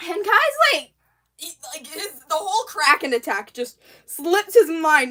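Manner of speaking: ordinary delivery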